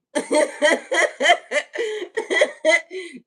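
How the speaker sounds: long, high, loud cackle; real laughing fit. Real, not acted. Host tickled by her own singing, cackling. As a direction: laugh helplessly in loud peals.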